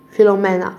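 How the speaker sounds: ordinary delivery, nothing marked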